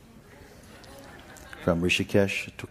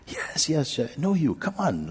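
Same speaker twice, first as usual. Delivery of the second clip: high, coaxing yes yes; eager insisting; 11 semitones higher than usual. Retold or imitated. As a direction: keep insisting cheerfully. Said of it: "like this, he was talking".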